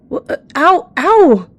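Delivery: high, loud cries of pain; pained yelps. A narrator's reading. Young cat just slashed by a stranger, yelping in pain. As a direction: yelp in shock, stammering into a cry. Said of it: "trembling in pain".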